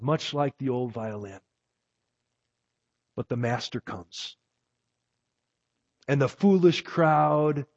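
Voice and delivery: a sermon poem read with feeling; storyteller's reading of a poem; poem recitation. Acted.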